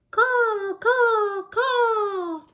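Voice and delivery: high, loud cawing crow. Acted. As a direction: caw like a crow calling out. Crow voiced in story.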